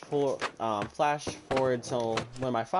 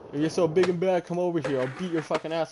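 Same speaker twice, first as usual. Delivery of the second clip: imitated neighbour squaring up, pitched higher; mildly raised, mock-aggressive dare; retold fighting challenge; 4 semitones higher, faster than usual.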